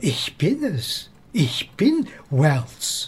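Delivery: slow, emphatic, stress on bin; slowly insisting he is Wells; slow insistence. Acted. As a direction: drag it out, leaning on bin. Insisting on his own identity.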